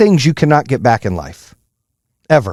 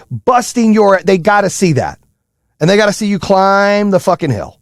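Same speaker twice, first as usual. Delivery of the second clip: full-force preaching; 7 semitones higher than usual. Real, not acted. Coach preaching to parents about grinding, full force. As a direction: pound every verb like a pulpit call.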